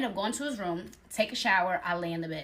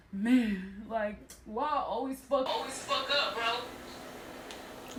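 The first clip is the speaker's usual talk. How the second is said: mock self-pitying mumble; hammed-up poor-me lament; playing the victim; 3 dB quieter, much slower than usual. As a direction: imitate a man sorry for himself, fading out at the end. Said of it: "They do this victim thing".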